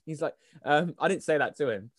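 ordinary talk

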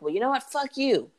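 mock argumentative. Acting out one side of an online spat, mock argumentative.

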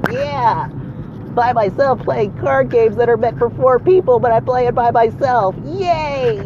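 high, slow mock-cheerful voice; sarcastic cheer. Mocking his lonely card-game nights, fake cheerful. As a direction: fake excitement about playing alone.

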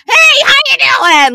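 high, loud, gushing hello; bubbly greeting. Retold or imitated. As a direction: imitate a friend breezing in with a big cheery hi. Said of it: "this bitch rolls up and is like".

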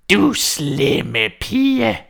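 witch's angry cry. Acted. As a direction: scold the girl in a drawn-out witch shriek. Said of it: "råbte heksen".

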